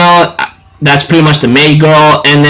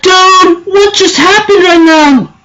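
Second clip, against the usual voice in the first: much higher, stunned dude; stunned disbelief; 12 semitones higher, slower than usual. Retold or imitated. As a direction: ask it in total shock. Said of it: "I'm like".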